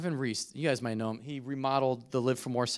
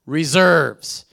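slow, drawn-out "always" in mom's voice; lightly voiced mom repeating her motto; mom's saying; 4 semitones higher, 14 dB louder, much slower than usual. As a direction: stretch each word like a mother's warning. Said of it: "my mom's saying is".

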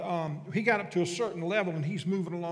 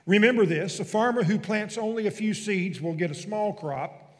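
louder, emphatic Bible reading; scripture reading; 4 semitones higher, 7 dB louder than usual. Acted. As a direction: read it out firmly to the congregation. Reads scripture aloud.

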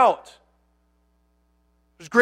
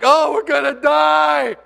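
mock panic; 5 semitones higher, slower than usual. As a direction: ham up the terror of doom. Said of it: "And they're like".

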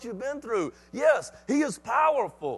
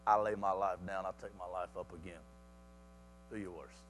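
very soft, low challenge; hushed, unafraid retort; quiet defiance; 14 dB quieter than usual. Acted. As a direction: dare them in a hushed low voice. Jesus boldly answers death threat.

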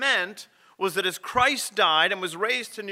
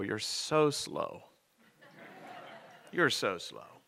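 gentle chiding; 8 semitones lower, 9 dB quieter, much slower than usual. Acted. Jesus gently chides disciples.

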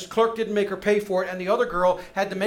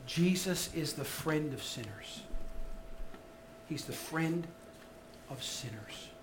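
softly tender; 5 semitones lower, 12 dB quieter than usual. Real, not acted. Tender sermon climax.

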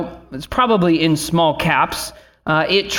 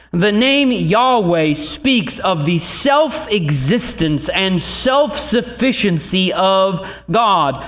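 ordinary talk

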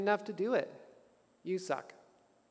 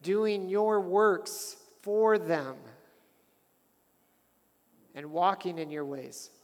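measured, earnest prayer voice; a pastor closing in fervent prayer; 3 semitones lower than usual. Acted.

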